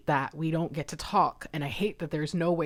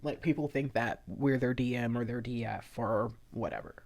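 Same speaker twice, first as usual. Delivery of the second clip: ordinary talk, nothing marked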